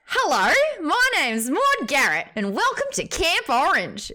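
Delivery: put-on high, loud Australian kids'-TV host accent; old strong Australian accent. Shown as a demonstration. Presenter mimics her old Nickelodeon self for mum, strong Australian accent. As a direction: belt out a broad Australian accent, pitched high. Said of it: "It used to sound like".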